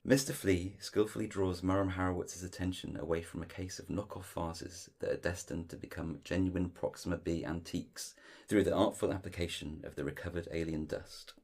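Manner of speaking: ordinary delivery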